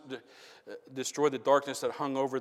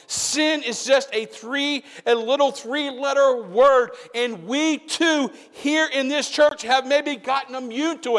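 full-force preaching; 14 semitones higher, 16 dB louder than usual. Real, not acted.

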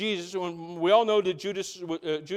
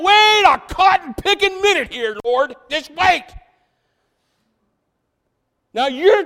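much higher, much louder, put-on shouting protest; excited Peter; 12 semitones higher, 14 dB louder than usual. Peter protesting to Jesus, a high loud put-on shout. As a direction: shout it like a flustered Peter stopping the Lord. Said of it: "Peter's like".

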